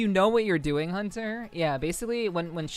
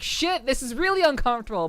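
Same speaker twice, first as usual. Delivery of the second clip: rising discomfort; 8 semitones higher, 4 dB louder than usual. Retold or imitated. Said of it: "And then, like".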